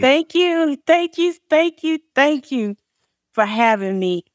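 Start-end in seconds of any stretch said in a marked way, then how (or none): 0.0-2.8 overjoyed thank-yous, pitched higher; high, excited gratitude. Real, not acted. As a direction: gush your thanks, thrilled to be invited. Guest gushes on being welcomed.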